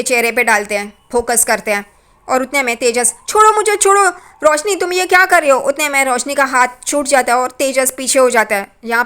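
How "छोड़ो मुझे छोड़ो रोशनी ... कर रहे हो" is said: frightened shout to let go; scared cry. Retold or imitated. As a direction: yelp it out like someone being choked. Tejas grabbed by the throat.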